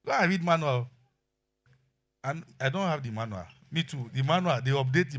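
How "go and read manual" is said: raised voice, pressing the men; preaching loud. Acted. Pastor challenging husbands, loud and high. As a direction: hammer the challenge home.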